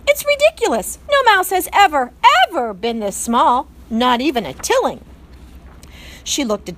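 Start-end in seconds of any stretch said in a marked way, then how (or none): 0.1-5.0 high, loud scoffing; scornful aunt. A narrator's reading. Aunt mouse mocking the newborn's size, scornful. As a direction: scoff at how tiny he is.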